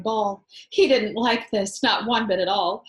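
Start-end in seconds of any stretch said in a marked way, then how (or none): none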